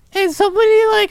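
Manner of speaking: usual speaking voice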